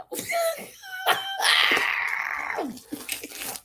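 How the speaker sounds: high, drawn-out cackle; shrieky cackle; screeching laugh. Real, not acted. Cracks up over shapewear remark.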